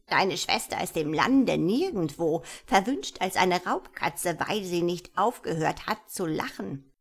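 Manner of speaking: loud, swooping gnome voice; vengeful wizard gnome. Acted. Gnome tells the girl of the curse, loud swooping voice. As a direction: pronounce the curse with relish, swooping.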